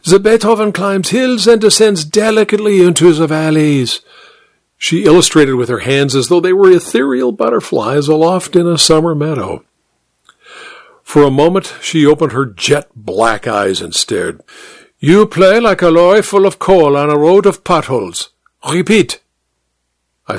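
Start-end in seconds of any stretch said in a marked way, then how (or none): 0.0-4.0 high, lilting Austrian piano teacher; Missus Fuchs, Austrian accent. A narrator's reading. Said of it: "her accent Austrian". 15.0-19.2 high-pitched, critical Austrian teacher; Missus Fuchs, Austrian accent. A narrator's reading. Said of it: "her accent Austrian".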